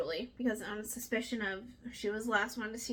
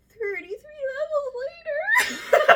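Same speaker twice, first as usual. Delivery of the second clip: high, strained joke voice breaking up; mock exhausted stair-runner; 12 dB louder than usual. Host imagining a run down the stairs, hammed-up winded voice. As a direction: squeal it out as if winded, then laugh. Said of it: "I just can't imagine running down those stairs".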